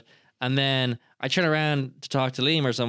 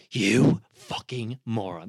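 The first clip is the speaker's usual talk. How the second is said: mock villain anger; 5 semitones lower, much slower than usual. Shown as a demonstration. Showing how he is painted as the villain, exaggerated slow menace. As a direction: draw it out like a villain. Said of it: "But I wasn't like".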